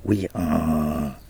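lightly brayed oui like a donkey; a hint of a donkey's hee-haw. A narrator's reading. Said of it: "plus fort que l'âne encore".

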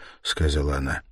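plain reading voice

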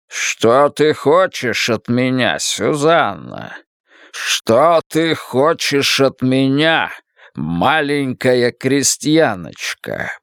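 slow, grand wizard voice; booming Oz voice. Acted. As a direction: question slowly like a mighty wizard. Oz voice questions.

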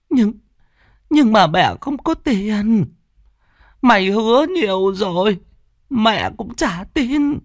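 put-on high-pitched, drawn-out elderly mother voice; worried old mother. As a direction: plead helplessly in an old woman's high voice.

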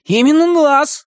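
high, drawn-out insistence; puffed-up little hero; reveling in bravado. A narrator's reading. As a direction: declare it proudly in a high voice. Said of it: "словно упиваясь своей храбростью".

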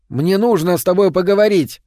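shouting. Acted. Visitor shouting through the door, loud. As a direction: make him hear you through wood.

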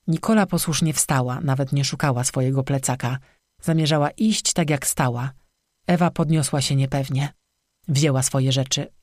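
ordinary delivery

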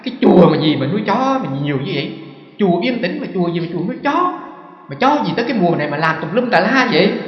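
monk quoting his own annoyed grumbling, mildly angry; irritated complaint. Retold or imitated. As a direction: complain loudly about the dogs. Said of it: "con nói".

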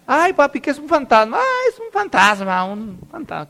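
susto fingido, agudo y juguetón; asústate en broma como ante Gasparín; susto de caricatura. Acted. Mocks childish Casper-style ghost scare. (susto fingido de caricatura)

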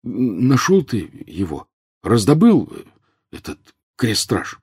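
slow, stammering question; wary, hesitant. A narrator's reading. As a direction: stumble cautiously through the question. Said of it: "очень тихо, словно боясь".

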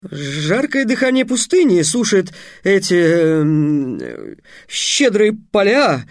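high, lofty speechmaking; a hint of a pompous young wizard; grandiose young mage. Trix makes a grand speech.

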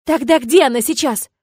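high loud demand; frantic shout. A narrator's reading. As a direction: shout it in panic.

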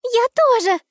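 put-on very high, loud girl's voice; little girl voice. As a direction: squeal it like an eager little girl. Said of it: "согласилась девочка".

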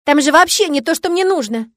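high, loud indignant yell; angry. A narrator's reading. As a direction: shout it out in outrage. Victoria keeps raging.

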